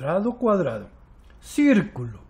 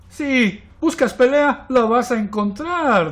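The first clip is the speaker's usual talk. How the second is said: raised, slowed-down warning; threatening; 6 semitones higher, 4 dB louder than usual. A narrator's reading. Triangle warning the little blot, threatening. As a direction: stretch the threat out, picking a fight.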